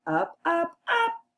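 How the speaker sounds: high, rising chant; climbing up. Acted. Repeats the climb for children.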